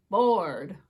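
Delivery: loud stretched bored; drawn-out loud moan of bored; emphatic bored. A narrator's reading. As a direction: lean hard on the last bored. Third repeat, loudest.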